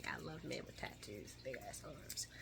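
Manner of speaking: very low, barely voiced murmur; hushed confession. Real, not acted. Host admitting she loves tattooed men, in a low murmur. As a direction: sink right down under your breath.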